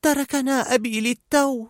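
high, worried character voice; Fear. A narrator's reading. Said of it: "قال خوف".

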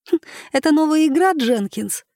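put-on high, quick girlish giggle through the question; a little girl teasing with a snicker; giggling girl. A narrator's reading. Girl at the picnic giggling to Jenkins, high and fast. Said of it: "прыснула одна из девочек".

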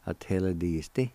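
"Ateladisti" run smoothly as one word; joined-up, normal pace. Shown as a demonstration. Teacher giving the word for join, joined-up normal pace. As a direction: say Ateladisti whole at talking speed. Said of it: "It's pronounced like this".

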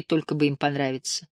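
ordinary delivery

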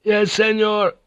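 high, level sailor's reply; called acknowledgment. A narrator's reading. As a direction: call back to the captain. Helmsman answers an order.

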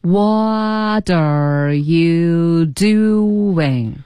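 every syllable stretched very long; super-slow pronunciation drill; extremely slow demo. Shown as a demonstration. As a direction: draw each word right out for learners to copy. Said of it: "我们再说几遍".

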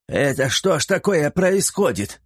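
put-on an old lord baffled by the uproar; puzzled old lord. A narrator's reading. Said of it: "спросил у жены Флаппий". (растерянный старый лорд)

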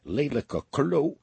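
slow, drawn-out insult; muttered curse. A narrator's reading. Burglar cursing a passer-by who nearly caught them, muttered curse. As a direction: curse him slowly under a mild grudge. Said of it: "vloekte Henkie".

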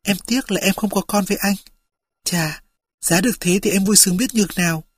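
soft, weak whisper; hushed and faint. A narrator's reading. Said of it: "Cô thì thào".

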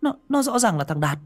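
put-on high-pitched boy, insistent and rising; a kid pushing back, voice climbing; boy's protest. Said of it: "Thằng Nam lên tiếng".

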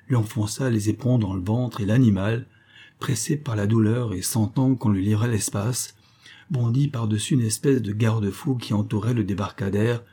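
plain reading voice

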